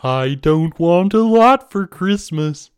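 high, slow, loud Muppet-frog singing; Kermit the Frog voice. Acted. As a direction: sing it like a puppet frog. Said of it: "Is that a Kermit the Frog impression?".

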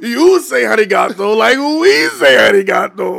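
high, loud, slow put-on voice; mock foreign voice. Still imitating the amazed locals, slow and high. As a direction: ham up a foreign speaker. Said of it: "he speaks of the language".

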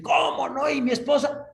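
high, loud, indignant shout; shouting in protest. Acted. Man protesting to Angel about his wife, alarmed shout. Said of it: "Y empezó a gritar y decir".

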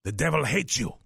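put-on a playful Polish accent, pointing a finger. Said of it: "'cause he had this beautiful Polish accent".

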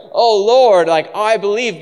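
high, loud show of belief; dutiful declaration. Acted. Believer saying what's expected, loudly declaring. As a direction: proclaim it as if you're supposed to. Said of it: "because you're supposed to say that".

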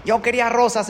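put-on high whining child's tantrum. Little girl throwing a fit at her father. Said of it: "empieza a hacer berrinche".

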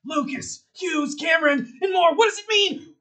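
loud, high, grand building announcement; energetic proclamation. Acted. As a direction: proclaim the names like an epic finale. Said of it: "This guy has lots of energy".

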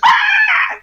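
single high raptor screech; one drawn-out screech to get attention; attention-getting raptor call. Shown as a demonstration. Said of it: "when I wanted to get his attention".

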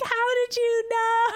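high, amazed disbelief; astonished. Retold or imitated. Recounting Ryan guessing the ship, amazed. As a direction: gasp it out in mock amazement. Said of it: "And I was like".

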